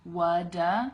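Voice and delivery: "What da" as two clear beats, "wuh-duh"; syllables joined. Shown as a demonstration. Teacher putting the pieces together, syllables joined. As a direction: join the pieces slowly, no T. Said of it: "So again, you have".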